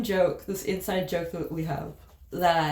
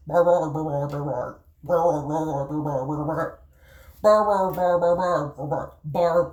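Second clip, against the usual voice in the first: mock babbling bar-bar, loud bursts; barbarian babble; 3 semitones lower, 4 dB louder than usual. Shown as a demonstration. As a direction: imitate foreign babble like a Roman hears it. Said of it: "what the Romans thought of English speakers".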